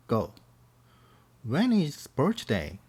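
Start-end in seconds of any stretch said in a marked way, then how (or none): 1.5-2.8 put-on very slow, every word drawn out for kids to repeat; slow teacher demo of the question; slow model question. Shown as a demonstration. Teacher models the question slowly for the class. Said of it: "선생님 먼저 가겠습니다".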